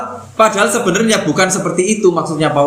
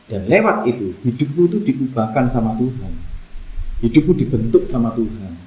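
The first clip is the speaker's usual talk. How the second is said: lower, softer, intimate preaching; hushed tender appeal; tender sermon; 7 semitones lower, 3 dB quieter than usual. Acted. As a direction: draw them in gently, softer and lower.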